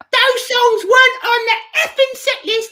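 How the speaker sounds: very high, strained outraged imitation; mock furious screech. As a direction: squeal it as an outraged wedding client. Imitating furious groom or bride.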